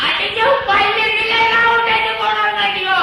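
comic blustering threat; loud threat. Acted. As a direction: call the threat out in a long singsong. Acted threat in a comic monologue.

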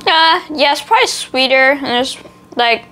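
speaking between bites; talking while chewing. Real, not acted. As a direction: mouth partly full of biscuit. Seen 3: Bites biscuit at mouth 0-2.7s, then talks holding it, likely chewing.

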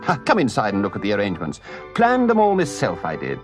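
high, rhapsodic enthusiasm; crying out proudly. A narrator's reading. Toad showing off the open road, proudly excited. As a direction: list each delight with a soaring burst. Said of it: "cried the Toad, straddling and expanding himself".

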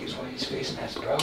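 hushed warning; whispering. Real, not acted. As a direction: whisper it under your breath. Warning quietly in the lab.

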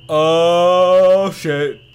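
loud, high, drawn-out cries; alarmed yelling. Real, not acted. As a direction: yell it out in startled disbelief. Figure suddenly vanishes.

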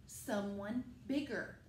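usual speaking voice

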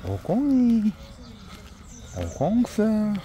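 high, drawn-out pet call; cooing to a dog. Real, not acted. Owner cooing the dog Ogong's name, high baby talk. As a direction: stretch the name in a high coo.

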